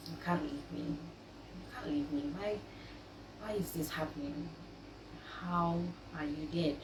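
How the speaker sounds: soft, pleading, mildly tearful; hushed retold plea to a lost husband; retold quiet pleading. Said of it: "I begged him to wake up".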